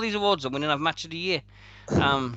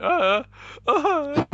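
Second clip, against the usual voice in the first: dazed groan; 14 semitones higher, 4 dB louder than usual. Retold or imitated. Retold dazed drunk grunt.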